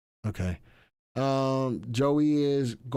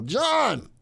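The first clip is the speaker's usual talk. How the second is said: shout; 12 semitones higher, 5 dB louder than usual. Real, not acted. Calling out for John.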